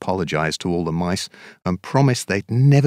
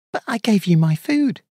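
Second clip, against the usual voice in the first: much higher, hurt little mouse; pleading Mouse; 13 semitones higher than usual. Acted. Mouse reminding Bear she shared her food, pleading and high. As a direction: protest like a wronged small mouse.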